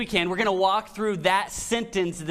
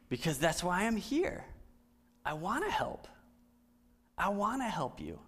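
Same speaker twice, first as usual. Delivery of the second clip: soft sincere; 7 dB quieter than usual. Real, not acted. Softly stressing wanting to help.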